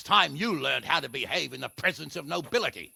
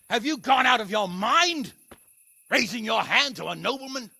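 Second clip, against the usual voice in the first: loud, higher scolding; angry rebuke; 7 semitones higher, 5 dB louder than usual. Acted. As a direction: tear into him for striking a noble. Hanush scolds the brawling commoner.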